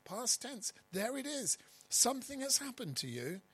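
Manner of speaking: emphatic lift. Acted. Emphatic climax on certainty.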